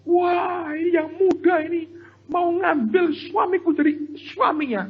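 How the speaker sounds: imitated wife's high-pitched jealous wail; very high, mock indignant accusation; jealous rage. Wife accusing the young woman over husband, jealous rage. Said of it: "Ngamuk, cemburu".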